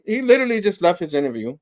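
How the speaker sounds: usual speaking voice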